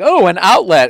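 high, loud mock excitement; delighted discovery. Toddler ignores safe toys for a hazard, delighted. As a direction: imitate a toddler thrilled by a find.

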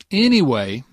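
usual speaking voice

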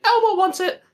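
very high squeaky puppet voice; Elmo voice. Retold or imitated. Friend retelling a Sesame Street clip, very high Elmo voice. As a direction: pitch way up like Elmo. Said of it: "he was like".

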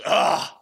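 mock exasperated grunt; imitated grunt. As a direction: grunt like a man giving up. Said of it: "grunts, rolls up his window".

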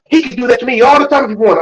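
high, loud, rushed retelling of her claim; imitated insistence. Quoting his sister-in-law in their argument, imitated insistence.

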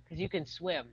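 usual speaking voice

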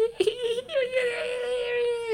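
shrieking high laugh; high squealing laugh. Real, not acted. Laughing hard at his own girlfriend impression.

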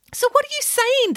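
very high-pitched challenge; exasperated demand. A narrator's reading. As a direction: demand an explanation in disbelief. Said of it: "demanded the vice principal".

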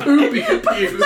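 slow, giggling Poopy Puke; laughing. Real, not acted. Friend savours the silly nickname, laughing. Slowly relishing the silly name.

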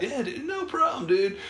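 pitched high, soft and eager; eager agreement. Retold or imitated. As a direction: sound keen, up high but quiet. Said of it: "And I'm like".

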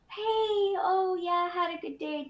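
high sweet voice; cheerful mom. Retold or imitated. Mom pretending all is fine, high and sweet. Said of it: "it would go from".

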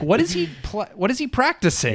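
high-pitched mock bewilderment; retold bafflement. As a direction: act out being totally baffled. Retells own baffled reaction.

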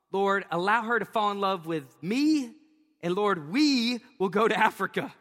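put-on a young man bargaining with God, rising hopeful pitch; hammed-up pleading prayer. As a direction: sell the deal to God, playfully pushy. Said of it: "I was like".

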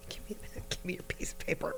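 breathy whispered aside with a laugh; whispering. Real, not acted. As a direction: whisper it off-mic. Whispered aside while recording.

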